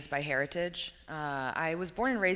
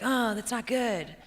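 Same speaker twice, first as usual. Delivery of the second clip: slow and higher, a light imitation of a disapproving teen; mock prim disapproval; 5 semitones higher, slower than usual. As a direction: lightly play your younger self frowning on the doobie. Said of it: "I was like".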